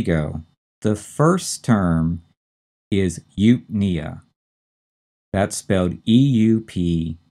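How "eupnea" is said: "eupnea" slowly, stress on the NEA; stress on second syllable. Shown as a demonstration. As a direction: say "eupnea" as yoop-NEE-uh. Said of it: "notice the stress is on the second syllable".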